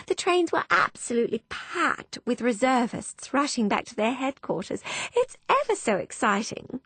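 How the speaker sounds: bubbling over with news; a touch of giddy chatter; gushing girl. Acted. Fanny gushing.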